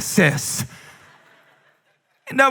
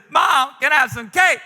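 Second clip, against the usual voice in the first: put-on whiny kid voice; 7 semitones higher than usual. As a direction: beg like a three-year-old. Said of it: "and he says".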